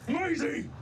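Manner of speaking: high, urgent call; Porter's urgent shout. Acted. Porter yells to Maisie across the fight, urgent high shout. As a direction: call out sharply in alarm. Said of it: "And Porter goes".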